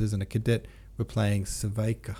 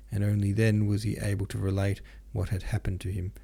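ordinary delivery

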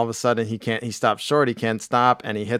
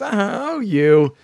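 very high mock giggly voice; hot lady; 8 semitones higher, 3 dB louder than usual. Car show lady answering the next guy, mock coy laugh. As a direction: put on a high coy brush-off.

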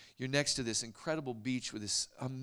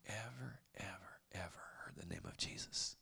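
very soft, voiceless whisper; hushed whisper; 4 semitones lower, 13 dB quieter than usual. Acted. Preacher asking the congregation if they see, whispered.

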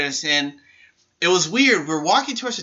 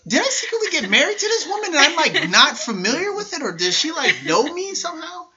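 high-pitched, hurried disbelief; baffled rant, voice shooting way up; incredulous; 12 semitones higher than usual. Retold or imitated. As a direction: retell utter bewilderment in a squeaky-high pitch. Baffled by date acting like wife.

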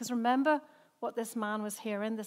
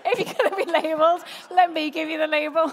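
laughing; 9 semitones higher, 11 dB louder than usual. Real, not acted. Laughing at her own parenting.

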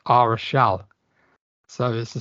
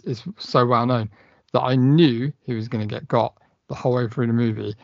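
ordinary delivery

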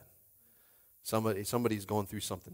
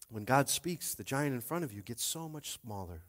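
usual speaking voice

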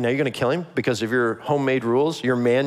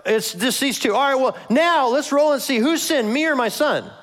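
mock exasperated Saul; 12 semitones higher than usual. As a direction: throw up your hands, pitched way up in disbelief. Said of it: "so now it's like".

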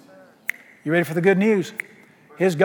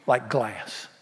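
hushed; 7 semitones lower, 7 dB quieter than usual. Acted. Describing the still sea.

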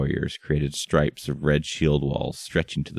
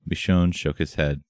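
plain reading voice

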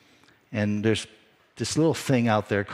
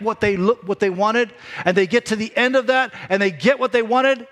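emphatic; 9 semitones higher, 5 dB louder than usual. Real, not acted. Preaching climax on wanting.

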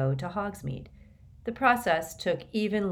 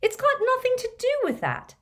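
put-on much higher, quick, flustered girl's voice; flustered Hermione; 4 dB louder, faster than usual. A narrator's reading. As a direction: fire it back fast, embarrassed and defensive. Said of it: "said Hermione, her cheeks reddening".

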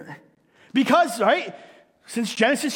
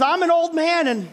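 sassy; 6 semitones higher than usual. Retold or imitated. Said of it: "he's getting a little sassy".

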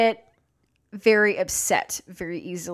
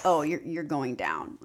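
low and soft, a cold warning; quiet menacing threat; 5 semitones lower, 8 dB quieter than usual. Retold or imitated. Waiting in the shell with a bazooka, low threat. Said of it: "and then be like".

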